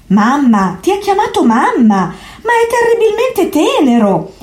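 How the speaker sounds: laughing exclamation. A narrator's reading. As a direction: melt with amused tenderness. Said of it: "riuscì a esclamare Diderot".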